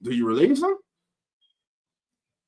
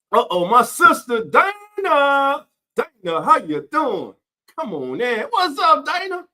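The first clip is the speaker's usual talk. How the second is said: hype greeting; 9 semitones higher, 3 dB louder than usual. Real, not acted. Greeting a viewer who joined the live stream, excited high call. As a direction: call out the name like a hype man.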